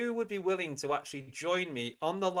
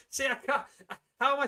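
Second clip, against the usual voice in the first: breaking into laughter mid-line; can't hold it in, laugh through the words; 9 semitones higher, faster than usual. Real, not acted. Cracks up reading a comment.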